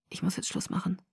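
very soft, feeble voice; weak, hushed. A narrator's reading. Sick boy says he must hang up, weak and hushed. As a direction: end the call with little strength. Sick friend ends the phone call.

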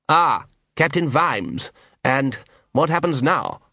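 slow, detached lordly drawl; calm lord, unbothered; cool aristocrat voice. A narrator's reading. The patrician speaks calmly.

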